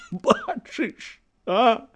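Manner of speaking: high, choked, cracked question; strained, broken high voice; voice breaking. A narrator's reading. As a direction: let the voice crack on it. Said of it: "голас ягоны перасекся".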